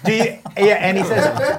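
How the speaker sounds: ordinary talk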